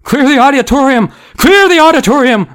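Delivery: high, loud frantic order; shouting. A narrator's reading. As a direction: shout the evacuation over a panicking crowd. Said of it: "Missus Berman was shouting".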